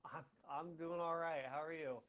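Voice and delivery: barely audible, mic too far; faint voice through a bad mic; faint audio. Real, not acted. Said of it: "You sound a little faint there".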